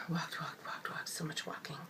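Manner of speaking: fading whisper, voice worn out. Real, not acted. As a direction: let each walked trail off into a whisper. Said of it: "excuse the gravelly sound and the whispering".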